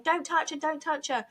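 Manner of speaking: high, urgent mock cry; retold screaming. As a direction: imitate panicked bystanders at a contained volume. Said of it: "And people screaming".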